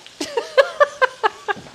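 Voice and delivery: a sudden squeal of a laugh, way up high; high-pitched laugh. Real, not acted. Laughing in disbelief while telling viewers about the betrayal. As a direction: crack up, unable to keep a straight face. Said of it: "I can't even say it with a straight face".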